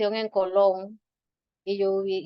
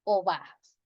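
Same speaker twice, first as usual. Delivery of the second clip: correct form; 3 semitones higher, 5 dB quieter, slower than usual. Shown as a demonstration. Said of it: "Uno dice".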